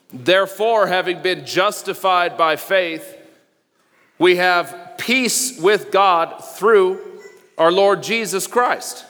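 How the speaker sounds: slow, deliberate reading of the verse; scripture reading. Real, not acted. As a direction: read each phrase slowly and weightily. Reading scripture aloud slowly.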